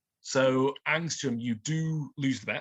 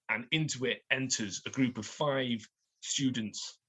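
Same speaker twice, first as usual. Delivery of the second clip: ordinary talk, nothing marked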